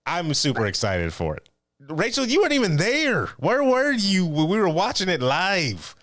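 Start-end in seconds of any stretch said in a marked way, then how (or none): 1.9-5.9 high-pitched playful scolding, slowing on the question; mock outrage. Teasing a co-host who missed the live show, mock outrage. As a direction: call out a friend in exaggerated, high-pitched disbelief.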